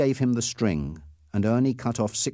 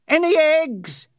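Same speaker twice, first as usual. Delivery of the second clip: high, loud, slow call over the lake; shouted across; 8 dB louder, slower than usual. Acted. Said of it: "Ernie shouted".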